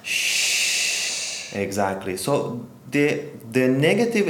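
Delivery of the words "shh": long unvoiced shh like rain; soft shushing. Shown as a demonstration. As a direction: shush softly, like falling rain. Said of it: "like making a sound of the rain".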